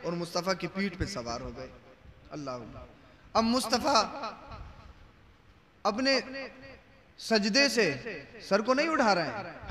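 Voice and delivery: ordinary delivery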